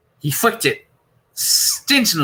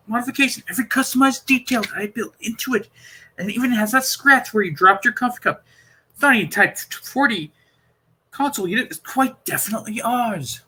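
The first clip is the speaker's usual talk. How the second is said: higher, eager Doctor voice, puzzled and insistent; 10 semitones higher than usual. Acted. Doctor explaining the console to Perry, puzzled and insistent. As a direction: play the Doctor working it out aloud.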